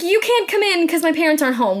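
a hint of a girl turning someone away; girl refusing entry. Girl to the monster at her door, lightly voiced refusal.

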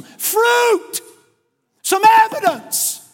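high, loud, drawn-out shout; stretched cry of exhortation; forceful demand. Acted. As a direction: demand proof at full preaching voice. Voicing John demanding proof of repentance.

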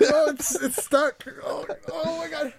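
high strained pretend push; a hammed-up strained groan of effort; mock straining.

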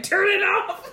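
mock protest. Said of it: "And you were like".

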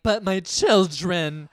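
loud, drawn-out tearful wail, mocking; mock crying. Podcaster mimicking Jen's constant crying, mock tearful wail. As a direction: imitate her overdone weepy plea. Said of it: "keeps crying and being like".

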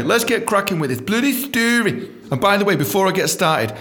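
usual speaking voice

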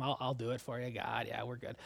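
barely bothered, fading to nothing; reluctant mumble. Pretend. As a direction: shrug it off under your breath. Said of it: "I don't get the idea here that Isaiah was like".

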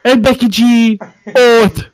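loud hammed-up outburst; shouted reenactment. Reenacting a video for friends, loud and hammed up. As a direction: belt it out in mock outrage.

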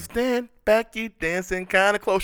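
mock woman's voice.

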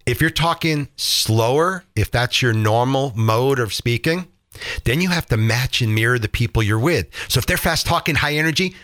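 normal talking voice

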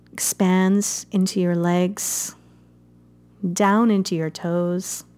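gentle meditation guide, unhurried; guided meditation. Acted. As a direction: ease the listener into calm breathing. Leads a guided fire meditation.

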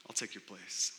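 soft, quiet, gentle offer; Jesus quietly offering to take your place; tender offer. Retold or imitated. Jesus stepping in for the guilty defendant, soft and tender. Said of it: "and says".

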